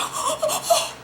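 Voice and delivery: sharp, high gasp of shock. Retold or imitated. Angela caught off guard, a high shocked gasp. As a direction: gasp like you just got caught. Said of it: "So Angela's shocked".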